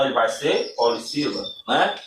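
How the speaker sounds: usual speaking voice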